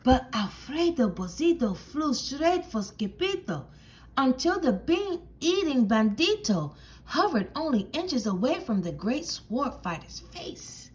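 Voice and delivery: usual speaking voice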